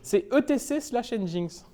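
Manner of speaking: slow, spelt out letter by letter; clearly articulated. Shown as a demonstration. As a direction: say "E T C slash Nginx" slowly, each part distinct. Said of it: "Ah, bah, c'était clair".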